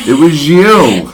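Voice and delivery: mock accusing. Blames host in mock accusation.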